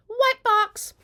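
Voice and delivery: very high, loud squeal; squealed reveal. Acted. Revealing where the lace dress is from, squealing. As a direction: squeal out the brand name. Repeats the brand reveal higher.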